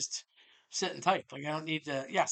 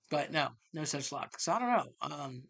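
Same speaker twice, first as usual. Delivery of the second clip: ordinary talk, nothing marked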